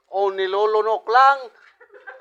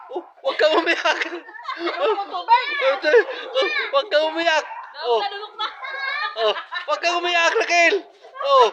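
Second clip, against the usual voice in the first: laughing tease; 7 semitones higher than usual. Real, not acted. Teasing again, laughing.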